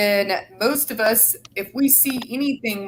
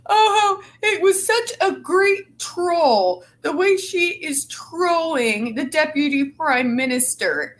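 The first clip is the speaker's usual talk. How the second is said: mock media gushing; 6 semitones higher than usual. Scornfully imitating media praising a troll, mock gushing. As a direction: mimic fawning praise in a raised, slowed voice. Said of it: "stupid assholes in the media act-, acting like".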